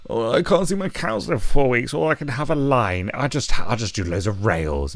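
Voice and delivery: a lightly put-on whiny voice mocking the excuse; half-hearted mocking impression; mock whiny addict. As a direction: sneer it like a self-pitying user. Mocking a cocaine user's excuse.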